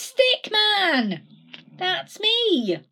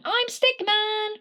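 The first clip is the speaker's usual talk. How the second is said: high, slow cry of his name; 13 semitones higher, slower than usual. Acted. Repeated name chant.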